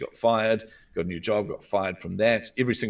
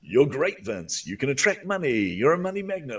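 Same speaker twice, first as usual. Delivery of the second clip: much higher, hammed-up cheesy self-praise; mocking affirmations; 6 semitones higher, 3 dB louder than usual. Mocking himself chanting affirmations at the mirror, high hammed-up cheer. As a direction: send up gushing mirror affirmations, pitched way up. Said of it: "standing in front of the mirror going".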